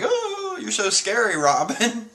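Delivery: high, cutesy impression of a scared little character; Chopper. Chopper finding Robin scary, high imitated voice. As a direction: start very high on a long "oh". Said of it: "Chopper's like".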